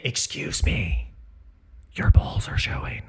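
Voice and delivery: whispering an embarrassing warning. Retold or imitated. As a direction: lean in and whisper the secret.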